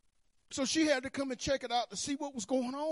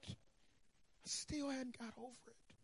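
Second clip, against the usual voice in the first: much softer, slow, drawn-out repeat; soft slow echo; 3 semitones higher, 13 dB quieter, much slower than usual. Shown as a demonstration. Preacher echoing his own line, softly and slowly. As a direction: let it sink in, softly and slowly.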